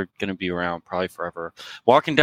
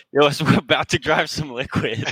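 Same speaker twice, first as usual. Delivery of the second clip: laughing quote; 5 semitones higher than usual. Retold or imitated. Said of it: "we're like".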